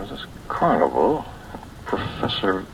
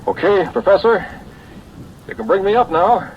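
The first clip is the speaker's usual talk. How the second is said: loud and much higher, calling up to the surface; 12 semitones higher, 8 dB louder than usual. Acted. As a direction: shout up the shaft, cheerful. Calling up the shaft.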